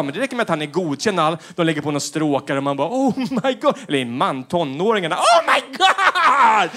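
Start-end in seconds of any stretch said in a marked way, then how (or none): 2.9-3.7 higher, awed gasp; higher mock-awed viewer; mock awe. Viewer moved by talent show. 5.2-6.7 high, very slow, loud squeal of amazement; high, very slow, loud teen squeal; hammed-up teen awe. Teenagers amazed.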